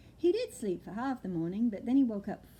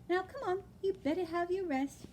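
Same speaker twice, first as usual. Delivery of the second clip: higher, gently coaxing mother; coaxing mother owl; 10 semitones higher than usual. Acted. Mother owl sending Plop to rest, coaxing. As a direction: settle the little one down.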